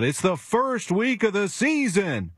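imitating Tony La Russa. Manager brushing off offense question, high slow imitation. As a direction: drag it out like the testy skipper. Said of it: "and he said".

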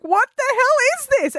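high, alarmed; freaking out. Retold or imitated. Guest freaking out in the dark, high mock alarm. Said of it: "And I'm like".

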